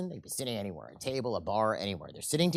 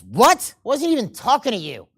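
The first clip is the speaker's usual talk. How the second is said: sudden loud, higher indignant snap; affronted angry retort; 8 semitones higher, 10 dB louder than usual. Acted. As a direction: shoot back at the nervous man. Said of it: "The fellow who's angry all the time says".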